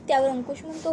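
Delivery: ordinary delivery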